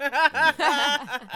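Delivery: laughing high and loud. Real, not acted. Laughing about turning thirty.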